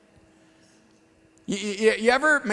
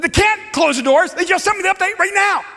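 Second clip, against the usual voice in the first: raised, indignant protest; retold protest; 7 semitones higher, 4 dB louder than usual. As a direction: argue back, voice climbing. Arguing with gate agent.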